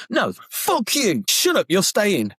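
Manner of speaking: high-pitched imitated telling-off; acted grumpy insistence; mock irate. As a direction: cut off the apology, mock-cross. Woken man overrules caller's apology.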